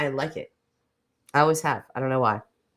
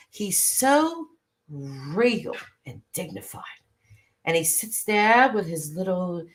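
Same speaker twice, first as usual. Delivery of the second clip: usual speaking voice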